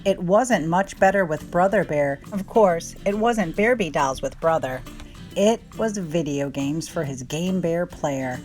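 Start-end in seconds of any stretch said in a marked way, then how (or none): none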